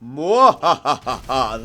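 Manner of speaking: slow evil cackle; evil laugh. Acted. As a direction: cackle like a villain. Villain laugh after gloat.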